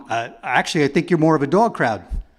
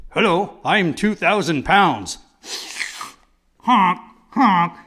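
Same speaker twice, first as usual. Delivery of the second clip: a hint of a lumbering two-ton creature; lightly voiced ton; 3 semitones higher, slower than usual. Comedian playing a gross ton, lightly voiced. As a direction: honk like a big goofy beast. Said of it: "Here is a gross ton".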